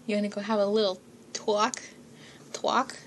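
mock New York tough-guy accent leaning on "talk"; Jersey accent. Lightly voiced Jersey accent, teasing about the word talk. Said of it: "like a really thick, heavy New York or, like, Jersey accent".

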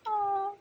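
high, quiet moan of pleasure; hushed high moan; soft moan. Acted. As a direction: let out a small high moan.

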